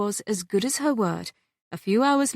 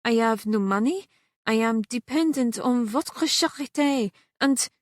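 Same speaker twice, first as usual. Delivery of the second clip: lightly voiced French maid, humble and pleading; French accent; 4 semitones higher than usual. Celeste admits to Catherine she has no money, in a light French accent.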